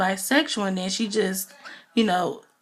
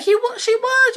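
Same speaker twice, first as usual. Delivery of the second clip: flustered squeak; 5 dB louder than usual. Retold or imitated. Flustered reply, voiced.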